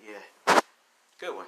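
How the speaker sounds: short laugh into the reply; acted chuckle. Son reacting to his dad's joke, amused. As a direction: laugh briefly, then answer.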